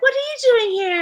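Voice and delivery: high, quick, badly imitated accent. Singer curious about a young model, bad imitated accent. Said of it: "doing a very bad [name] accent".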